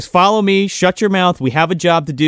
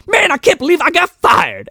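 high, whiny disbelief; whiny Carter; 11 semitones higher than usual. Retold or imitated. Carter whining about getting fired, high and whiny. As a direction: moan it way up high like a sad sap. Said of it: "T K Carter's like".

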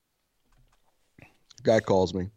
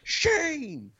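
very high, slow calling-out voice; retold yell upstairs; slower than usual. Neighbor explaining himself to the woman upstairs, high mock yell. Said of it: "to yell up to the third floor".

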